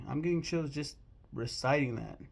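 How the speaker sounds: usual speaking voice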